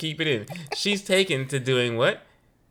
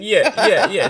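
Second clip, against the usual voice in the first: ordinary delivery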